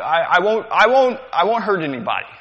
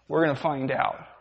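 ordinary talk

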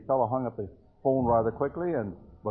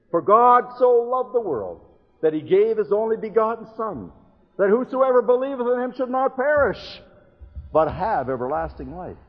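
louder, higher declaiming of the verse; preached scripture; 8 semitones higher, 8 dB louder than usual. Acted. Preacher proclaiming John to the young people, loud and ringing. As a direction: proclaim it to the congregation with full force.

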